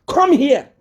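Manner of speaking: high, mocking imitation of a boastful cadre. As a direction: ape the cadres in a high, taunting voice. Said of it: "those of you P F cadres that are saying".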